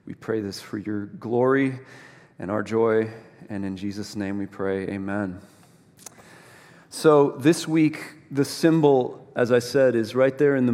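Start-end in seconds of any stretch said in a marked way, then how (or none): 0.0-5.4 low, soft, unhurried praying voice; prayer. Real, not acted. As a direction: lead a quiet prayer, pausing between phrases. Closing a spoken prayer.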